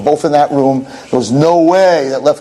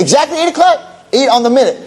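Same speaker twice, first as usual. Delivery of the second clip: much higher, eager and pressing; urgent excitement; 12 semitones higher than usual. Acted. Friedman urges the nurse to the window, high and eager. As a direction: hurry the nurse along.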